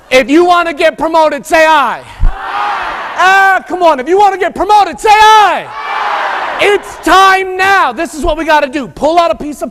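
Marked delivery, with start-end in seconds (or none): 0.1-7.9 preacher-style rally cry; rallying the crowd. Real, not acted. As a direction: pump up the room. Calling on audience to respond.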